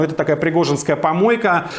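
normal talking voice

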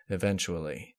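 usual speaking voice

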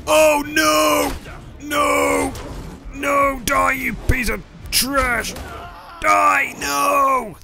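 frantic high yelling at the game; desperate gamer yelling; panicked shouting. Real, not acted. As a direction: scream at the dinos as you lose. Losing fight to dinos.

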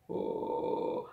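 deep creaky croak like a pond frog; vocal fry croak. Shown as a demonstration. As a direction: croak low and loose. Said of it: "a vozinha do sapo na lagoa".

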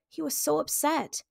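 ordinary delivery

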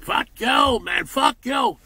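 angry shout. Acted. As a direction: spit it out at the hypocrites. Ranting at phony people.

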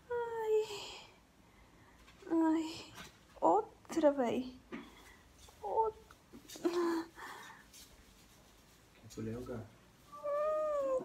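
high pained cries and hums; pain moans. Real, not acted. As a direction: moan through the contraction.

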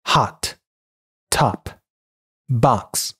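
American hot, top, box with open "ah"; American English demo of hot, top, box; American pronunciation. Shown as a demonstration. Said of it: "美音".

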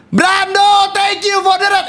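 high-pitched excited shout-out; excited thanks. Real, not acted. Streamer thanking Brando for a donation, excited high shout-out. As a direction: call out the donor's name, high and drawn out.

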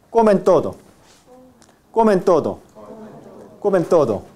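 "comen" with n on the teeth before "todo"; dental n. Shown as a demonstration. Teacher drills class on assimilation, dental n in "comen". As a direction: run "comen todo" together, tongue touching teeth. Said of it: "ápico dental".

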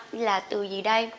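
ordinary delivery

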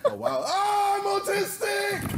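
high, slow, shouted shock; shocked outburst. Acted. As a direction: blurt it out in mock alarm. Shocked reaction to meeting her.

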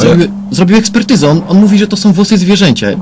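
bursting to share the discovery; excited. Acted.

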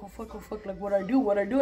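hushed panicked whisper; frightened whisper. Retold or imitated. Crouched in the house, whispering in fear. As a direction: whisper it, terrified and hiding.

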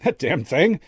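high, loud startled exclamation; shaky laugh. A narrator's reading. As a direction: blurt it out, a touch shaken. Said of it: "he laughed shakily".

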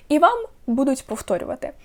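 ordinary talk, nothing marked

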